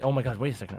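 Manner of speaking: usual speaking voice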